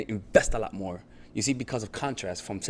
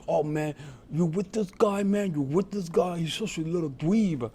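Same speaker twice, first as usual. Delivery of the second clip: slow, higher sneering jerk voice; mocking dick; 5 semitones higher than usual. Rude guy taunting a man out with a girl, higher and slow. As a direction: taunt him like a guy looking for trouble. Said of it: "he's trying to be a dick".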